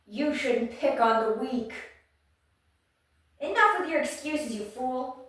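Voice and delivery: loud, firm telling-off; acted scolding. Reader voicing a hero against a bully, scolding. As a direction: rebuke the bully with force.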